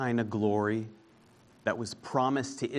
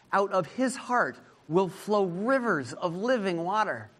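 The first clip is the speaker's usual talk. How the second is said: high, ringing preacher's cry; preaching at full force; 6 semitones higher than usual. Acted. Preacher crying out Jesus' words to congregation, full-force preaching. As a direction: lift the voice and call it out to the crowd.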